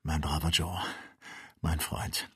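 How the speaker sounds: low, hushed affection; gentle and moved, pitched low; soft, tender. A narrator's reading. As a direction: say it softly to a dear friend. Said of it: "sprach der Doktor weich".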